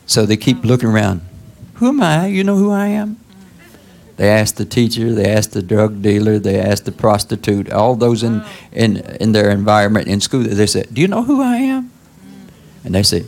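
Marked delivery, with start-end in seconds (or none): 1.7-3.2 lost youth asking. Retold or imitated. Young people asking around, acted. 10.9-11.9 high, pleading question; pleading youth. Retold or imitated. Young person asking the world, high and pleading.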